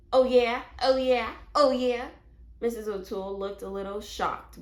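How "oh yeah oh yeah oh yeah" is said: loud, squawky taunting parrot; loud parrot jeering oh yeah; cheeky parrot. A narrator's reading. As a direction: repeat it like a mocking bird. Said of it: "Penelope blinked her eyes and said".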